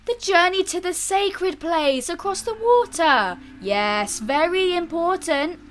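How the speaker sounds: high, wild proclaiming; excited madman. A narrator's reading. Madman on the sacred journey, excitedly.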